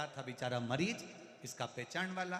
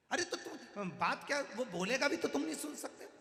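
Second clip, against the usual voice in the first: high, louder, laughing through the words; laughing retort; 10 semitones higher, 5 dB louder than usual. Retold or imitated. Grandson laughs at grandfather, scoffing and amused.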